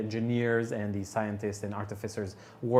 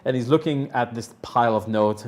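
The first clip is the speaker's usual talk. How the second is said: ordinary delivery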